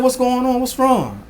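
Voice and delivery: high-pitched, concerned questioning; concerned dad. Retold or imitated. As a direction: re-enact a parent checking on an upset child. Father voices concern to upset daughter.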